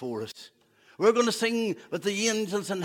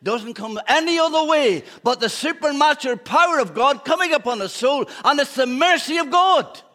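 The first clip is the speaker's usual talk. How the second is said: raised, loud preacher's cry; fervent preaching; 7 semitones higher, 7 dB louder than usual. Acted. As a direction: preach it out with full force. Preaching at full force.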